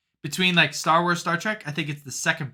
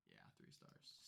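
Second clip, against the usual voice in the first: breathy whisper, much softer; whispered aside; 38 dB quieter than usual. Real, not acted. Repeating her film rating to herself, whispered. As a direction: murmur it to yourself.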